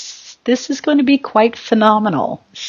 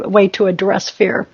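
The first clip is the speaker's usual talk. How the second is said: ordinary delivery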